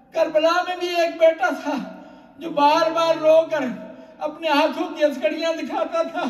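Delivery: loud, high, wailing sermon climax with sobs in the voice; impassioned majlis preaching. Acted. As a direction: cry it out to the mourners, building grief. Majlis climax, preacher at full force. (پرجوش گریہ آمیز مجلس خطابت)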